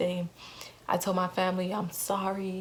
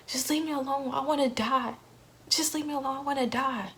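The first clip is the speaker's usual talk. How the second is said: higher, pleading cry to be left alone; desperate plea; 7 semitones higher than usual. Retold or imitated. To her roommate at the door, a higher desperate plea. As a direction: beg them to go away. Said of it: "And I'm like".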